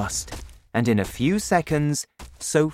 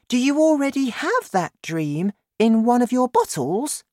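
put-on high child's voice, questioning; puzzled girl; 12 semitones higher, 4 dB louder than usual. A narrator's reading. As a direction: ask high and curiously, as a girl. Narrator voices Sophie.

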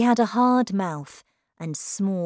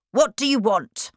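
higher, louder, impatient snap; angry demand; 5 semitones higher, 6 dB louder than usual. A narrator's reading. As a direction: bark the question like an annoyed headmistress. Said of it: "looked at her angrily".

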